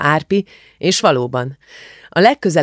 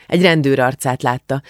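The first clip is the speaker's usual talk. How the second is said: plain reading voice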